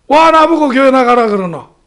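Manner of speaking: loud, high-pitched indignant retort; irritated father. Retold or imitated. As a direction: snap back at a nagging son, loudly. Father snaps back at son on phone. (버럭 짜증 내는 아버지)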